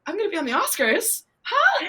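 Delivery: high-pitched disbelief rising to a squeal; incredulous shock. Retold or imitated. Acts out reaction to news.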